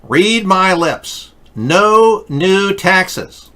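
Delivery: slow, high, emphatic promise; slow, emphatic, presidential; political speech. Retold or imitated. Quoting famous political line.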